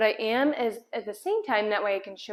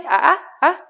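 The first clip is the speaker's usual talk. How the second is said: warning ah ah ah; 5 semitones higher, 10 dB louder than usual. Real, not acted. Correcting dog breaking stay.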